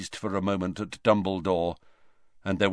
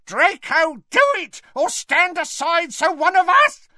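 very high, loud screech; 6 dB louder than usual. A narrator's reading. Woman orders Draco to act, screeching. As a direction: screech it at the top of the voice. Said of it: "screeched the woman".

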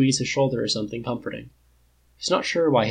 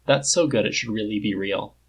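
ordinary delivery, nothing marked

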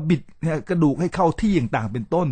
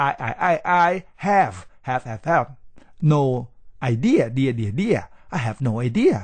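each word of "I have no idea" drawn out slowly with its tone; drilled high-rising tones; much slower than usual. Shown as a demonstration. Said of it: "จะเป็นเสียงตรีหรือเสียงจัตวา". (ไล่เสียงตรี เสียงจัตวา ทีละคำใน "I have no idea")

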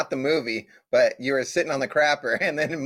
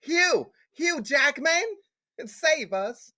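put-on London accent; 11 semitones higher, slower than usual. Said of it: "Why did it go to a London accent".

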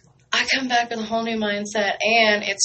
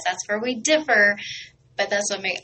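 usual speaking voice

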